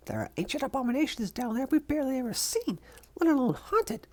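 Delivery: ordinary talk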